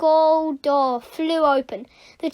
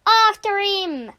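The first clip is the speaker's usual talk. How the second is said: loud, high, drawn-out order; slow rallying pirate shout; shouted command; 7 semitones higher, 5 dB louder than usual. Acted. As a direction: send the crew charging. Pirate captain ordering the chase.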